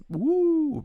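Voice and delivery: loud, high sung whoop; high "woo". Shown as a demonstration. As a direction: let out a soaring demonstration "woo". Demonstrates what the voice can do.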